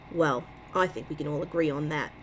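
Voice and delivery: put-on a weak, barely there New York accent; terrible New York accent. Reading Bill Serafini's line in a failed New York accent. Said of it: "That is a terrible New York accent".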